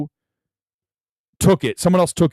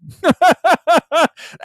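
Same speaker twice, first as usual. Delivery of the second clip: high burst of laughter; 6 dB louder than usual. Real, not acted. Laughing at his own reluctance.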